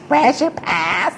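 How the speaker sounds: a hint of a suspicious hall monitor. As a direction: catch a kid in the hall. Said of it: "caught in the hall without a pass".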